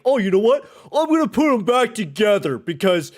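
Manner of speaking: high, loud, mocking imitation of the coach; sarcastic coach impression, pitched high and loud. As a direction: ham up the clueless coach, way up in pitch. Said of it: "and then was like".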